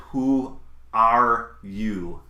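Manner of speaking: very slow, word by word retold question; weighty, stretched-out challenge; drawn-out question. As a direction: space out each word for dramatic weight. Angel's question replayed for emphasis.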